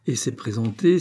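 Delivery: ordinary delivery, nothing marked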